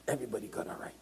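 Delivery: near-whisper, very soft; hushed repeat. Real, not acted. Preacher repeating everybody's right to the congregation, hushed. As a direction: drop to a whisper to let it sink in.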